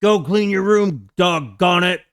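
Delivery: loud, scolding parent voice; imitated parental telling-off, loud; yelling parent. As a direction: yell it like a parent fed up with a kid. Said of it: "my parents yelling at me as a kid".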